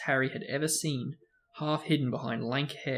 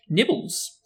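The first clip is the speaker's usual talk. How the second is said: sweet; 8 semitones higher, 6 dB louder than usual. A narrator's reading. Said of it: "he said sweetly".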